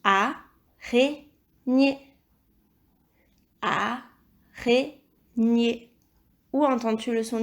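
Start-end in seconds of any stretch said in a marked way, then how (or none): 0.0-2.0 araignée. very slowly split in three; long gaps inside araignée; syllable by syllable. Shown as a demonstration. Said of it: "il s'agit de la troisième syllabe". 3.6-5.9 Araignée. slowly broken in three; syllable by syllable. Shown as a demonstration. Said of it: "il s'agit de la troisième syllabe".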